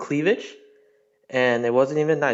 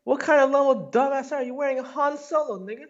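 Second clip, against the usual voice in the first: very high mimicking voice; squeaky parroting of the other man's rant; mocking echo; 13 semitones higher than usual. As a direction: throw the insult back in a high mock voice. Caller mimics host's insult back.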